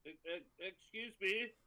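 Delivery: calling out. Acted. Player calls out to group.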